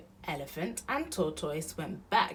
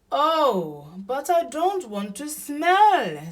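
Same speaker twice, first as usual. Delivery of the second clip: high, loud, drawn-out whine; dismayed Elephant; 9 semitones higher, 12 dB louder, slower than usual. A narrator's reading. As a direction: protest like an upset young animal.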